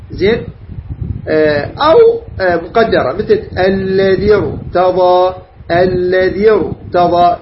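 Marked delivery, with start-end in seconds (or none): 3.6-7.4 "الذي ارتضى" slowly, linked, with thick R; heavy R after kasra. Shown as a demonstration. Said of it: "يعني هذه الكسرة الذي". ("الذي ارتضى" ببطء مع تفخيم الراء)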